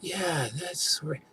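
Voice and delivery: dreamy euphoria. Retold or imitated. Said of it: "I'm just like".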